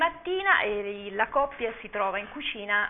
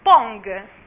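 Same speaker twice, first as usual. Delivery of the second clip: a loud, bouncy "Pong!"; toaster's exclamation; 9 dB louder than usual. Acted. Toaster popping out the toast, loud and cheerful. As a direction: pop it out like a toaster springing. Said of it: "esclamò a quel punto il tostapane".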